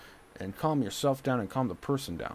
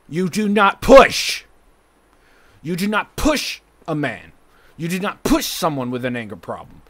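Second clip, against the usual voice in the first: loud, higher, heavily punched words; angry outburst; 5 semitones higher, 11 dB louder than usual. Real, not acted. Son scolding mom about pushing an angry man, angry outburst. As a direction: lay down the law, hammering each phrase loudly.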